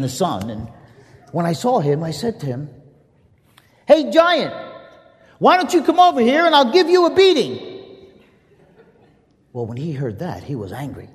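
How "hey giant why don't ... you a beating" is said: high, loud taunt across the field; boy yelling a dare at a giant; shouted challenge. Acted.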